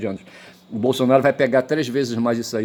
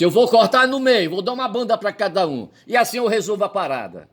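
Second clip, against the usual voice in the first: said with fierceness; 9 semitones higher than usual. Retold or imitated. Solomon threatens the two mothers, fierce and raised. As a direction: threaten to cut the baby in half. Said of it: "Eu tô falando assim com brabeza".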